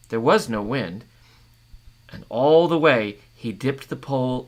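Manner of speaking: measured storytelling read; story narration. A narrator's reading. Reader sharing a northern tale with listeners, storytelling voice. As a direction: read the tale aloud to the group.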